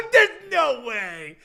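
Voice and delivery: very loud, high, drawn-out incredulous laugh-talk; laughing disbelief. Real, not acted. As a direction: burst out laughing in disbelief, stretched out. Reacting to the played clip.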